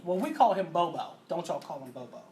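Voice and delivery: ordinary talk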